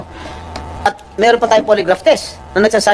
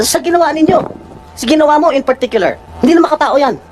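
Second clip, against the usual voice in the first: real heated indignation, higher pitch; raised voice; 5 semitones higher than usual. Real, not acted. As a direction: reproach them with voice raised in outrage. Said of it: "tumataas ang boses ko".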